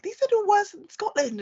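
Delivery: upset singers' voice. Retold or imitated. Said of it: "And they're like".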